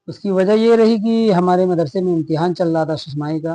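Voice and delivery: usual speaking voice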